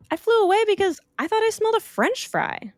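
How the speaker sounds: very high, fast bird voice; a flighty bird rattling off excuses; chirpy bird. Acted. Bird explaining to Opal, high and fast. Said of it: "The bird said".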